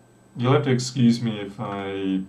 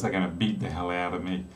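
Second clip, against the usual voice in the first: normal talking voice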